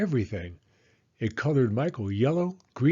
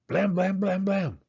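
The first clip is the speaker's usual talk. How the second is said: high, punchy blam for each knock; knocking sound; 7 semitones higher than usual. A narrator's reading. Sheila pounding on the fire station door, high punchy knocks. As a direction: bang it out like a fist on a door.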